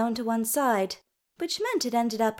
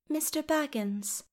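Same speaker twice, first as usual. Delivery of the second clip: soft, higher-pitched gentle correction; 6 semitones higher, 6 dB quieter than usual. A narrator's reading. Dys corrects the Elven King's form of address, softly. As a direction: correct him quietly, voice lifted. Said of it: "Dys's correction was soft".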